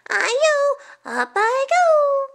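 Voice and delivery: very high, loud cartoon call; gleeful rice cake cry. A narrator's reading. Rice cake spinning up a street, high gleeful cry. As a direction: let the cry soar upward.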